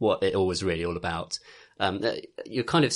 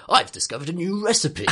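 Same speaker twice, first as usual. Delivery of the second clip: lightly voiced game chef announcing a recipe, higher; a hint of a proud cook's put-on voice; mock-grand chef announcement; Ignis; 8 semitones higher than usual. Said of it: "And he'll go".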